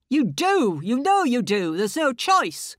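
loud, high, harsh insistence; bully her into it; bullying inner voice. Acted. Inner voice overrules the woman, loud and angry. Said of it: "this awful bullying voice".

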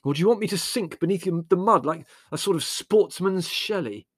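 high, loud, scathing question; vitriolic. A narrator's reading. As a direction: pile on the bitter scorn. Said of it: "asked Larry vitriolically".

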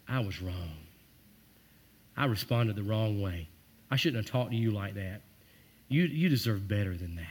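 put-on quiet sincere apology to a child; humble apology. Shown as a demonstration. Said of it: "and you say".

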